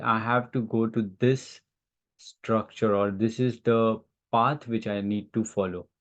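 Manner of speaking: ordinary talk, nothing marked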